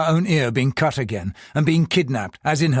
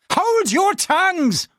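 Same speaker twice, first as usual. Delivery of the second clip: higher, slow roaring order; slower than usual. Acted. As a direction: silence the whole house. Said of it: "Philip Chen roared".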